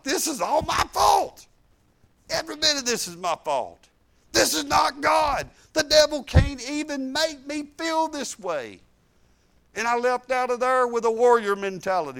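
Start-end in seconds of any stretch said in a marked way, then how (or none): 0.0-8.8 higher, ringing cry of self-blame; realizing shout. Retold or imitated. Preacher retelling his breakthrough, higher and shouted. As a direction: burst out with the realization. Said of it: "I'm like".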